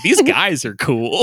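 slow, mock-awed enthusiasm; mock admiration. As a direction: play an impressed fanboy, stretching it out. Imagining Tarantino's excited reaction.

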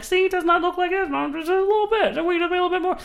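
mock Moore. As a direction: sell the chart like a smug huckster. Mocking Moore again.

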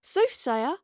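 high, rising echo; incredulous question. A narrator's reading. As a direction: query the word in disbelief. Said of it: "asked Astrid".

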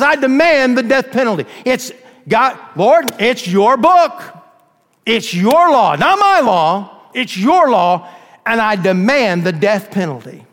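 fierce demand. Acted. Prosecutor insists to God on the death penalty, loud. Prosecutor appeals to God's law.

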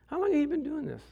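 high, soft nonchalant question; casual curiosity. Acted. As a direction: lean in with an unworried, playful query. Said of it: "He just kinda leans over and goes".

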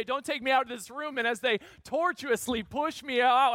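ordinary talk, nothing marked

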